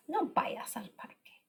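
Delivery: soft, breathy whisper of the sentence; hushed model sentence; whispered repeat. Shown as a demonstration. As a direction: whisper it back to the class. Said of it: "teacher repeats sentence softly".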